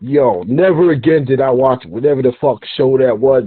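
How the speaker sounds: normal talking voice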